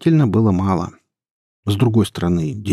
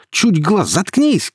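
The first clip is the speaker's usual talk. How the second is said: high, furious yell; raised, agitated command; shouting; 11 semitones higher, 4 dB louder, slower than usual. A narrator's reading. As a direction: silence her with an angry cry. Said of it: "крикнул Илья".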